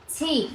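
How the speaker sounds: ছি as English T with a puff of air; aspirated T. Shown as a demonstration. Teacher answering what happened to T, aspirated ছি. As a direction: release the T of ছি with audible breath. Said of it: "টি এর ক্ষেত্রে কি হলো?".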